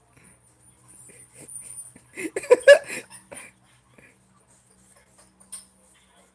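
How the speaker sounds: breathless silent wheeze of laughter; wheezing laugh. Real, not acted. Giggling fit after repeating I'm done, wheezy laugh.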